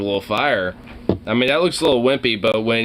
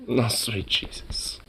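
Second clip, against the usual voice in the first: stunned whisper; 9 dB quieter, slower than usual. Real, not acted. Shocked at the video.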